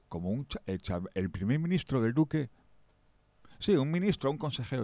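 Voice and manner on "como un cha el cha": usual speaking voice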